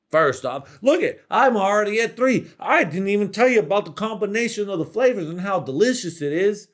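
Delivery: high, loud gushing about gravy and cheese curds; loud, high excited foodie rave; hyped-up food lover. Acted. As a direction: sell it like the best dish ever. Bit: fan lists poutine toppings.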